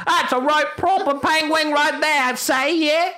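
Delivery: high-pitched exaggerated British accent. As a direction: squeal it in hammed-up British. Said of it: "we know he's from Britain".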